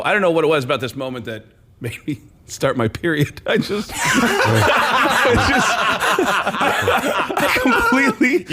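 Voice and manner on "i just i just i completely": very high, slow, laughing words; giddy laughter. Retold or imitated. Retelling meeting his hero, high giddy laughing. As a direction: giggle helplessly. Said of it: "like, lost it".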